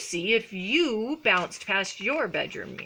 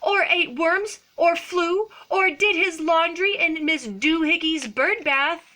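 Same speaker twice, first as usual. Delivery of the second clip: loud, high mock-cross mother; scolding; 9 semitones higher, 7 dB louder than usual. A narrator's reading. Mama cat tells off her kitten, hammed-up scolding. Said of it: "she scolded".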